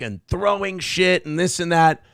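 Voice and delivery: ordinary talk, nothing marked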